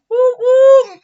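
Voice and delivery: high, loud "woo woo"; excited high-pitched cheer; celebrating cheer. Real, not acted. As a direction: whoop it up in triumph. Celebrates getting all five languages.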